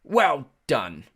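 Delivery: patronising British well-wishing; British-like accent. A narrator's reading. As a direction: condescend in a strange British accent. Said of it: "He had a strange accent. British maybe".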